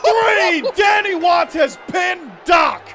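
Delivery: loud, slow, emphatic announcement; excited call. Real, not acted. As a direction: land the result with full force. Announcing the upset pin.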